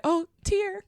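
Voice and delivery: high, teary mock sob of joy; a playful fake-tearful cry; mock tearing up. Mock tearful reaction to video.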